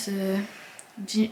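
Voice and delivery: ordinary talk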